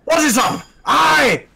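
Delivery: high, shouty robot villain bellow; Shock Dave voice. Acted. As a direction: boom it out like a hammy alien robot. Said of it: "Well, Shock Dave".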